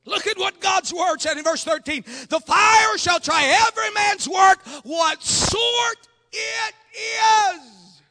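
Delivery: high, loud, pleading pulpit cry; full-force preaching. Acted. Preacher pleads to be faithful before God, full-force. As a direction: drive each phrase out, stretching the last words.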